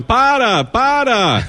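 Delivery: put-on high little girl calling out para para; little daughter in Target saying para; child's voice. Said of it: "my daughter was going".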